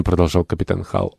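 ordinary delivery

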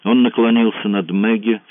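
ordinary delivery, nothing marked

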